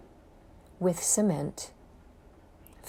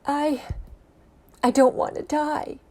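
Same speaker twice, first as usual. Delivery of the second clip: pleading cry; 7 semitones higher than usual. Acted. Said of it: "I started to cry".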